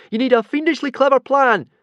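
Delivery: shrill panicked yell from below; frantic high shout; wild shout. Acted. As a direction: shout it up wildly. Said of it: "Fishlegs shouted out wildly".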